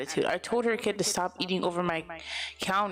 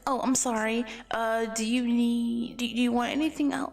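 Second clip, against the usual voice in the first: high, hesitant politeness; apologetic service voice; 5 semitones higher than usual. Retold or imitated. Nervous worker offering the customer more, high and apologetic. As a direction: stammer politely in a raised voice. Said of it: "that's how I literally sound".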